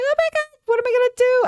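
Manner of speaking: high mock freakout over cute foxes; mock squeal. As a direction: squeal it like a gushing fan. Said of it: "It's like".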